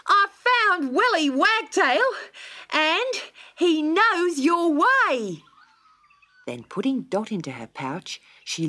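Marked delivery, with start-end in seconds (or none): 0.0-5.5 much higher, louder, slow eager voice; bursting with good news; excited kangaroo. A narrator's reading. Kangaroo tells Dot the news, high and excited. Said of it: "panting with excitement".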